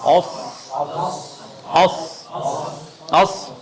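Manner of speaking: اس said thick with a heavy s; heavier s. Shown as a demonstration. Teacher showing the heavier letter to students. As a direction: make the s of اس fuller. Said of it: "اس سے موٹا کیا ہے؟".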